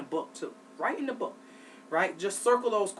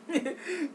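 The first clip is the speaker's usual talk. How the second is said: quick high chuckle; a brief, real laugh at your own joke; little amused laugh; short chuckle; 11 semitones higher than usual. Real, not acted. Amused at own remark about sleep.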